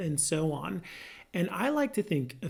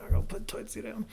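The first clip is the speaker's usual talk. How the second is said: fast, higher, rushed apology; husband scrambling to fix it, fast and eager; hurried sheepish promise; 5 semitones higher, much faster than usual. Retold or imitated. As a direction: rattle it off like a caught husband. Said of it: "I'm like".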